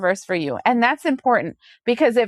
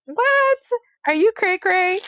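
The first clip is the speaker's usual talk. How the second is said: much higher, a shocked "What?" then teasing; mock disbelief; 9 semitones higher than usual. To a friend repeating the same mistake, a high shocked disbelief. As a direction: pop the pitch up, aghast, then mock. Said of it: "And you're like".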